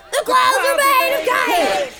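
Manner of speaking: high, excited chant; gleeful high-pitched group cheer; whooping and hollering. Acted. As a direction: holler it like a partying crowd. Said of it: "partying and whooping and hollering".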